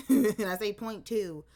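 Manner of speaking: loud, laughing as she says it. Real, not acted. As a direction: laugh through the words. Laughing through her own point.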